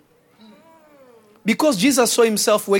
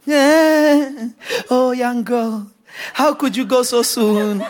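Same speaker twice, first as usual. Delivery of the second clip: mock wailing; slower than usual. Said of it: "a crying party".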